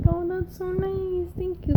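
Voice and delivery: high soft grateful voice; sweet thank-you. Retold or imitated. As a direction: gush a sweet little thanks. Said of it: "And I'm like".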